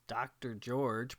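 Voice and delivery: ordinary talk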